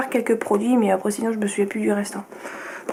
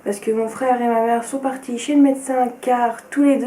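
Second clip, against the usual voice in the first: ordinary delivery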